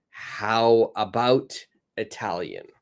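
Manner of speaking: teacher modelling a suggestion slowly; slow model suggestion. Shown as a demonstration. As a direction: stretch the how, let Italian fall away. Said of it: "So I've got".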